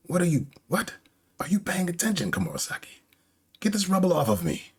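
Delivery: high, indignant acted demands; visibly upset. Orochi trapped under rubble orders Komurosaki, upset high voice. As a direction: demand attention in flustered outrage. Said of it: "he's visibly upset".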